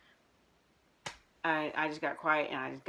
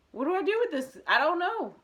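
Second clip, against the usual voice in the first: flustered; 12 semitones higher, 6 dB louder than usual. Retold or imitated. Baffled by his calm response.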